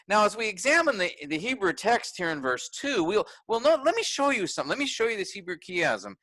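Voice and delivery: hammed-up pedant who can't resist the Hebrew; overly technical preacher. Preacher parodying himself dragging the congregation into details, lightly mock eager. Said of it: "go into all the details all the time and say".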